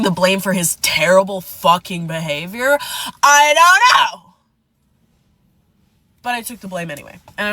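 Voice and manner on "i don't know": exasperated. Real, not acted. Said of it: "rhetorical shrug".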